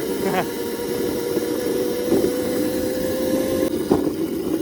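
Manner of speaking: squealing laugh while riding; high laugh. Real, not acted.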